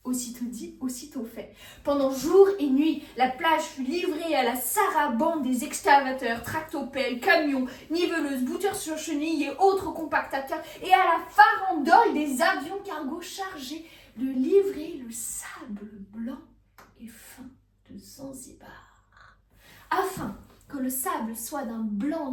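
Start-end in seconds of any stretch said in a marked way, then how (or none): 1.8-16.4 loud, driving list of machines; frenzied enumeration. A narrator's reading. Frenzy of construction machines narrated. 17.0-19.4 fading into a slow whisper; hushed reverence. A narrator's reading. Reverent fade on precious sand.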